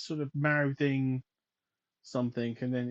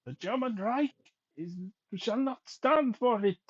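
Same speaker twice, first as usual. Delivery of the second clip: high, stern German villain voice; movie villain german accent; 7 semitones higher than usual. Acted. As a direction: bark it like a film Nazi officer. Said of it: "That's better".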